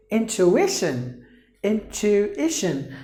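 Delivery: teacher marking the stress in intuition. Shown as a demonstration. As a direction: lift the TU in intuition. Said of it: "you have to give the stress here".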